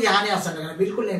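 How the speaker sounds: usual speaking voice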